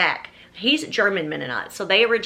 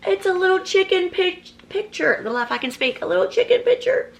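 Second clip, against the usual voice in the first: excited gush; 9 semitones higher than usual. Real, not acted. Describing the chicken picture.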